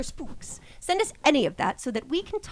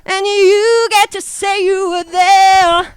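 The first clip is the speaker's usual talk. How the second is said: slow, loud, high announcer boom; grand announcer; 8 semitones higher, 14 dB louder, much slower than usual. Acted. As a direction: proclaim it like a showman.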